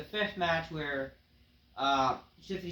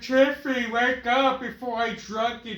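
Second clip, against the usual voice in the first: high, loud, slow put-on voice; hammed-up sleazy wrestler; Ric Flair Junior impression; 8 semitones higher, 8 dB louder than usual. Ric Flair Junior taunts Tiffany in a loud put-on voice. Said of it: "Ric Flair comes out and".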